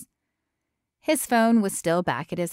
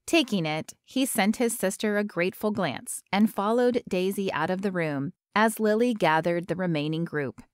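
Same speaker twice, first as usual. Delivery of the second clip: plain reading voice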